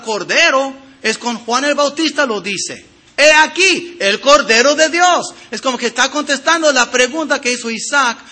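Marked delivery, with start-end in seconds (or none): 3.2-5.3 proclaiming. Retold or imitated. Quoting John the Baptist proclaiming.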